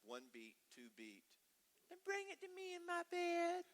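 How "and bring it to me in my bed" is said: put-on high little-girl call; little daughter's voice. Youngest daughter calling dad from her bed, high girlish voice. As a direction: call up sweetly like a small girl. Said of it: "she goes".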